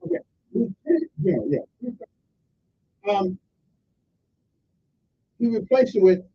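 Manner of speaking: usual speaking voice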